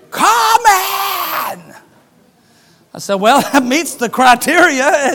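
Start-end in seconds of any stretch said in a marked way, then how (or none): 0.1-1.7 very high, very loud shout upward; man yelling his prayer at heaven. Retold or imitated. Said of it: "You said if I asked him". 3.1-5.1 amused answer with a laugh; preacher chuckling at the man's prayer; laughing reply. Retold or imitated.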